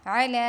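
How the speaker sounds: علی with ع from the middle of the throat, loud; throaty ain. Shown as a demonstration. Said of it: "عین کو وسط حلق سے پڑھیں گے". (علی حلق سے ع کے ساتھ)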